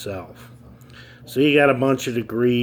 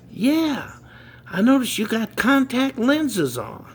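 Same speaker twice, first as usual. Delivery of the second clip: put-on much higher pitched boy's voice; kid voice; 12 semitones higher than usual. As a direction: play the smart kid, pitched way up. Third kid answers.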